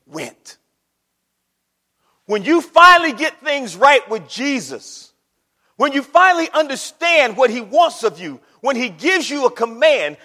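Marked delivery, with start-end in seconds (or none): 2.3-10.2 loud, high sermon build; ringing, emphatic pulpit cry; full-force preaching. Real, not acted. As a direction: exhort the congregation forcefully.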